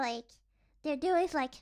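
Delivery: ordinary delivery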